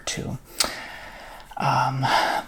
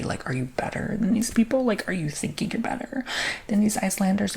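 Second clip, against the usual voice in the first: high-pitched incredulous questioning; affronted, raised-pitch questions; indignant challenge; 7 semitones higher than usual. Real, not acted. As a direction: challenge them, pitch climbing in disbelief. Challenging the rude woman's entitlement.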